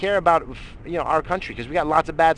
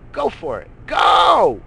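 sudden shout, far higher and louder on "Go!"; 8 semitones higher, 8 dB louder, much slower than usual. Real, not acted. Ranter yelling at complainers to leave, full shout. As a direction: blast them out the door.

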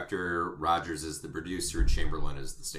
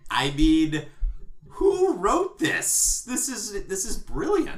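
high, drawn-out gushing of awe; amazed gushing; 13 semitones higher, 7 dB louder, much slower than usual. Real, not acted. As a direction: gush over the lyrics like a fan. Mock awe at chorus lyrics.

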